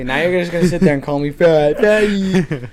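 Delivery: loud put-on hurt, rising at the end; mock offence. Accusing a friend of name-calling, mock offended. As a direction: play up being insulted.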